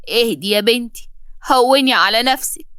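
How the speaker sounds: ordinary delivery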